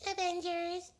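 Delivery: put-on high little-kid voice; piping small-kid answer. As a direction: say it like a small child. Child's one-word answer voiced.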